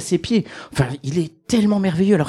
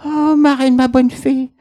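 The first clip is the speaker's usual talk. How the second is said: put-on high, surprised old lady voice; a delighted old woman greeting her fairy godmother; Cendrillon; 8 semitones higher, slower than usual. Old Cendrillon greets her godmother, high and surprised.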